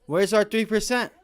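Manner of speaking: loud and high, calling the Fed out; mock street confrontation with the Fed chair, building to a shout; demanding confrontation. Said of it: "get up on them and say".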